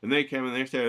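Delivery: usual speaking voice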